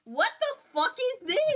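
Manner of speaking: high-pitched, incredulous outburst; incredulous outrage. Real, not acted. Reader reacting to the story's cop, incredulous. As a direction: shoot way up in disbelief.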